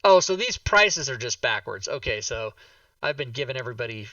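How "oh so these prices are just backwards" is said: usual speaking voice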